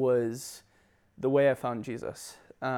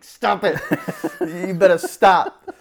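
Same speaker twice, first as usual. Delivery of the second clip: loud, laughing dad scolding; stern dad voice; 5 semitones higher, 10 dB louder, slower than usual. Retold or imitated. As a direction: bark it like a strict father. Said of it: "I'll switch on this dad voice".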